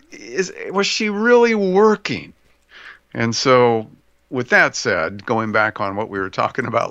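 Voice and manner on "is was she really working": incredulous. Real, not acted. Mock disbelief.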